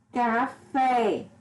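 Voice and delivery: "Café" drawn out, with a long falling FÉ; stress on last syllable. Shown as a demonstration. Teacher giving an oxytone example, stress on last syllable. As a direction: lean on the final FÉ of "Café". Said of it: "é a mais forte".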